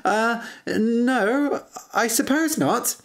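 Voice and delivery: awkward laugh, then halting words; nervous laugh. A narrator's reading. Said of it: "The man laughed nervously".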